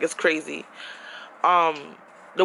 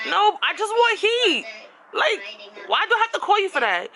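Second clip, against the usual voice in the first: mock pleading to the landlord, much higher; exasperated retort; 10 semitones higher than usual. Complaining to building management.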